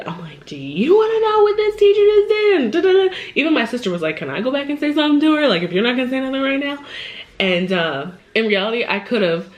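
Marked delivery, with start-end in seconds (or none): 0.5-3.2 much higher, louder furious rant; livid. Retold or imitated. Mom calling her partner, livid and loud. 4.2-6.8 higher, fast fired-up plea; eager sister. Retold or imitated. Sister begging to go back and confront the teacher, fast and higher.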